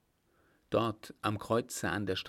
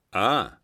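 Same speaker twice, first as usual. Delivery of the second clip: eager ah; 10 dB louder than usual. Acted. Reacting to ladies.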